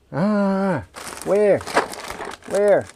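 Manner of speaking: high, loud cries, each dropping away; exasperated searching. Real, not acted. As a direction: call out in frustration for a lost thing. Frustrated hunt for missing part.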